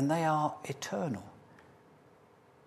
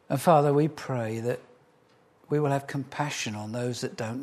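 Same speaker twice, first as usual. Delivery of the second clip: prayer; 3 semitones lower than usual. Real, not acted. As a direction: pray humbly to God for the church. Closing prayer.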